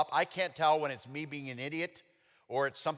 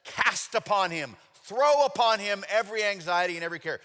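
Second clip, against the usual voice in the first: louder, higher, stressed verse; forceful quoting; 7 semitones higher, 9 dB louder than usual. Real, not acted. Preaching the verse to the congregation, loud and stressed. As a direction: punch the words like a throw. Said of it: "He uses the word throw".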